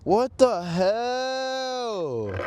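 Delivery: very slow, high, drawn-out wail; exasperated disbelief. Real, not acted. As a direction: stretch the last word into a long cry. Dismayed at the broken truck.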